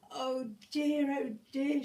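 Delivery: worried exclamation; exclaiming. A narrator's reading. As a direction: cry out in dismay. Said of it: "she exclaimed".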